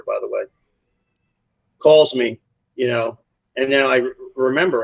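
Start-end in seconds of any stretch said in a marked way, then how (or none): none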